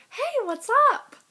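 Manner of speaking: high, loud, chirpy imitation of her; mock perky greeting. Girl plopping down beside an ex-friend on the bus, mock perky. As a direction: throw out an overbright hello, mocking her.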